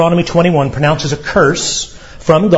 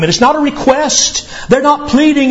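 ordinary delivery

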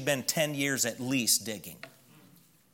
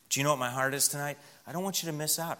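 ordinary delivery

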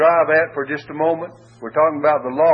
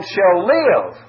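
"shall live" pushed higher and louder; stress on shall live; 5 semitones higher, 5 dB louder than usual. Shown as a demonstration. Preacher marking the key words, emphatic. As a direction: land hard on "live". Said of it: "the emphasis is upon".

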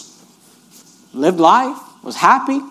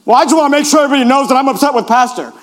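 fast, put-on sulky grumble; sulky churchgoer; 4 semitones higher, faster than usual. Upset member grumbling about the pastor, fast sulky voice. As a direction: play the sulking member. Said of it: "your face is like this".

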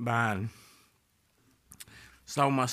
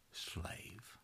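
whispered; 15 dB quieter than usual. Acted. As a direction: drop to a hushed whisper on the word. Whispered punch word.

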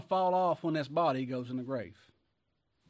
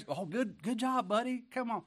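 mock cheering; 9 semitones higher than usual. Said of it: "And now".

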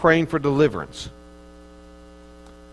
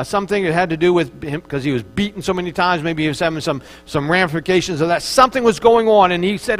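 ordinary talk

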